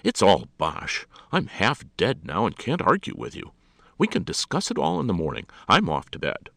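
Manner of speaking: loud, weary protest, rising in pitch; pleading protest. A narrator's reading. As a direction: plead your way out of it, exasperated. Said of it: "pleaded Alexander".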